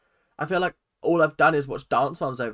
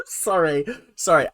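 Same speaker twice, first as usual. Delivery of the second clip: laughing apology, louder on the second sorry; 4 semitones higher, 5 dB louder than usual. Real, not acted. Distracted by her phone, laughing sorry to viewers. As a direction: giggle through the apology.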